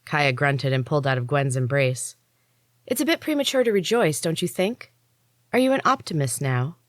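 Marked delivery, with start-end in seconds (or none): 2.9-6.8 dry skeptical teasing, higher voice. A narrator's reading. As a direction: needle her with doubt. Kaya skeptical retort.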